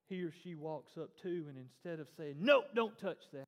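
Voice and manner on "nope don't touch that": put-on sharp, louder, higher no to a child; mock scolding. Adult stopping a child from touching, mock scolding.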